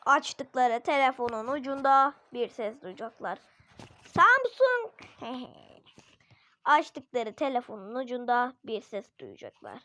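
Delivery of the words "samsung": tiz ve yüksek, reklam gibi söyle; telefon markası sesi. Pretend. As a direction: telefon açılış sesini taklit et. Parodying phone brand jingle. (telefon açılınca marka adını tiz söyleyen ses)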